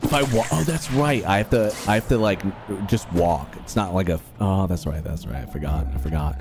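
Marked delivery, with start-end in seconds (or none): none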